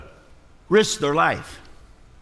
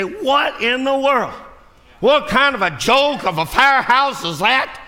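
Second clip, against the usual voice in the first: indignant scolding; 8 semitones higher, slower than usual. Acted. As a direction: lecture them like they've lost their minds. Preacher scolding lazy firehouse.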